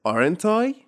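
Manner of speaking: Aren't I? rising, emphatic tag question; rhetorical tag. Shown as a demonstration. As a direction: ask Aren't I as if you already know. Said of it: "rhetorical question".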